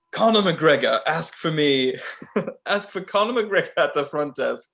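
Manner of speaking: hammed-up British hotel official; awful London accent. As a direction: barely keep the fake London accent from cracking up. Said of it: "this is a very awful accent".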